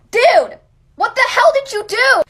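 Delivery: loud, high mock scolding; mad. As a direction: imitate an angry telling-off, loudly. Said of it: "gets mad at Peter".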